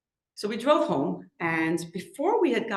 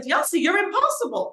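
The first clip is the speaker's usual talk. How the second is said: exasperated scolding; 8 semitones higher, 5 dB louder than usual. Retold or imitated. Frustrated mother scolding son.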